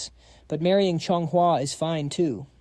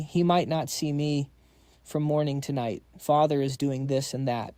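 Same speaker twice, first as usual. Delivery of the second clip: ordinary delivery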